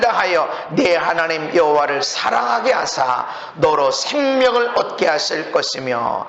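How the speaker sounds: low, measured scripture reading. Acted. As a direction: read the verse aloud in a low, even chant-like monotone. Reads scripture aloud to congregation.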